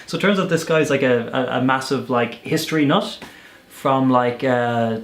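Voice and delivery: ordinary talk, nothing marked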